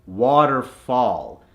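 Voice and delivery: waterfall slowed, "ah" in both halves; American accent. Shown as a demonstration. Coach gives the double example converted, American accent. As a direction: say "waterfall" American, as "wah-ter-fahl". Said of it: "The A H is in water".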